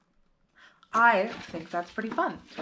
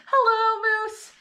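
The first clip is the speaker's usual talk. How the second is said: high, loud happy greeting; a girl overjoyed to see her dog; excited cry; 5 dB louder than usual. A narrator's reading. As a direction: cry out hello with joy. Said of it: "cried Zara".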